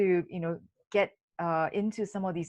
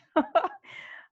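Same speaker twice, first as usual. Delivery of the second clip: laugh; 11 semitones higher than usual. Real, not acted.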